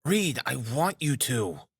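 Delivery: slow, high-pitched, pressing demand; insistent. A narrator's reading. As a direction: press each word hard, refusing to back down. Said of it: "he persisted".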